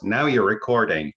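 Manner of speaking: lightly mimicked automated announcement; mildly mock an irritating software voice; annoying Zoom voice. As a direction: imitate Zoom's recording notice, slightly put-on. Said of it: "a really annoying voice that goes".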